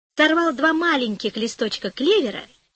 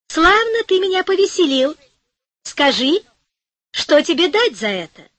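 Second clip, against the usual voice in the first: high, bright fairy voice; a grateful little fairy, lightly voiced; fairy thanking the shoemaker for dancing; 6 semitones higher than usual. A narrator's reading. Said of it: "фея сказала".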